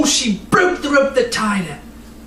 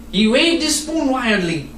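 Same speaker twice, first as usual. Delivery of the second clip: plain reading voice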